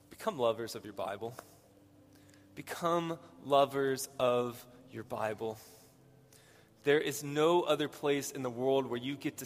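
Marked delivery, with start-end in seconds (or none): 0.1-1.3 quick, matter-of-fact first statement; brisk statement. Real, not acted. Preacher giving the congregation his first advice, brisk. 2.5-5.6 slow and weighty, spacing out the words; slow, deliberate repeat. Real, not acted. Preacher repeating his first advice to the congregation, slow and deliberate.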